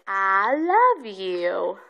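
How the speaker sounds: drawn-out, low prompt, LOVE stretched; slowly modelled. Shown as a demonstration. Mother coaching her toddler to say it, slowly drawn out.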